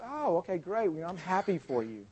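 soft, unruffled reply; quietly happy for a friend; gentle congratulation. Retold or imitated. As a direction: take the brag calmly and softly. Friend answers the boast without envy.

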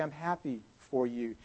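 low, soft, sincere reply; softly glad for him; gentle congratulation. Retold or imitated. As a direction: drop to a quiet kindness. Speaker imitates friend's kind reply.

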